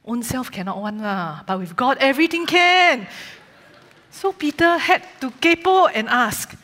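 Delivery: put-on light Singlish storytelling accent. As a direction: tell it in Singlish, Singapore style. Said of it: "this story is going to be in Singlish".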